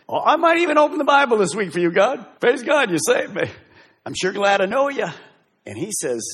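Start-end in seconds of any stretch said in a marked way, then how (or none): 0.1-5.1 breezy, fast, much higher mock voice; mock a half-hearted churchgoer; mock casual believer. Preacher mocking a casual believer praying to God, flippant.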